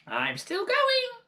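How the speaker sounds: slow, very high screech from above; screechy crone. Acted. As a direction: screech it down like a witch. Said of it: "with a screechy voice from above".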